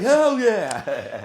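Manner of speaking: high, loud joking cheer; hyped mock reply. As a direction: cheer back like a thrilled stranger. Acts out stranger's delighted reply.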